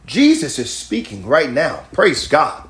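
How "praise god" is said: ordinary talk, nothing marked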